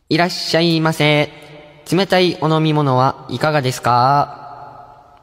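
slow, half-human half-mechanical announcement; talking vending machine. Acted. Host voicing a vending machine on a listener's request, halfway between human and machine. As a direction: drag out a slightly robotic automated greeting. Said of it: "喋る自動販売機風にお願いします".

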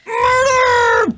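high, piercing war cry; shrill battle cry. Real, not acted.

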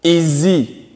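"Is he" run together as "iz-ee", no break between the words; linked words. Shown as a demonstration. French teacher to students, showing the liaison in "Is he". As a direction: link "Is" into "he" smoothly. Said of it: "Je fais la liaison".

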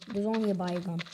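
usual speaking voice

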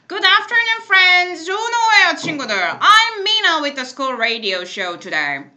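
bright, loud radio announcer voice; radio host. Acted. Teacher reading the radio script to class, announcer voice. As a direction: greet listeners like a school DJ.

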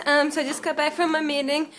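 usual speaking voice

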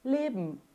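leben with the final E swallowed into a nasal M; correct pronunciation. Shown as a demonstration. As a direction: clip leben to lebm. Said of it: "Não é leben".

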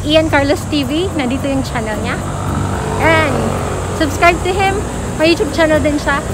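muffled masked speech; talking behind surgical mask; through a face mask. Real, not acted. Seen 1: Surgical mask worn over mouth and nose throughout; references unmasked.

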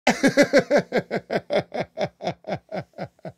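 long run of high, quick chuckles tapering off; real laugh. Real, not acted. Streamer cracking up at his own story, long laugh.

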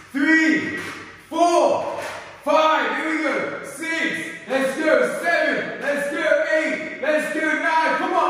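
high, loud rep counting; hype friend cheering. Real, not acted. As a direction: pump up your partner. Coach cheering through final reps.

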